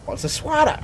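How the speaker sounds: high, innocent asking voice; high mock-innocent question; mock naive question. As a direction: play dumb, all wide-eyed. Voices his character's question.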